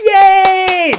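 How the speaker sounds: high, loud mock cheer. Cheering with the camper each time it thunders, loud and high. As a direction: cheer like a kid at fireworks.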